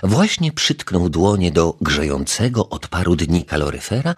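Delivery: plain reading voice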